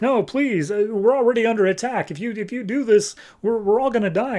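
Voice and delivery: high, stammering frightened plea; hammed-up desperate begging; mock pleading. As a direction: beg anxiously as a worried mother. Acted mother pleading under attack.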